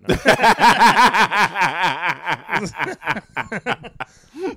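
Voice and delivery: loud laughter. Real, not acted. Comic cracking up at his Mario hat joke, loud laughter. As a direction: crack up hard.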